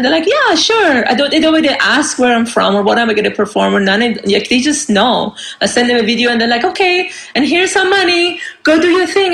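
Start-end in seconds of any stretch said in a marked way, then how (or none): 0.3-1.1 breezy yes. Retold or imitated. Promoter's easy reply. 6.6-9.3 breezy promoter. Retold or imitated. Promoter's voice quoted.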